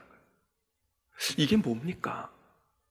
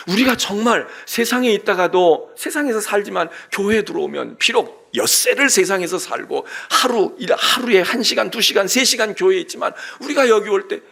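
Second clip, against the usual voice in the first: louder, driving sermon delivery; forceful preaching; 4 semitones higher, 7 dB louder than usual. Acted. Pastor urging the congregation, preaching with force. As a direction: press the point home to the pews.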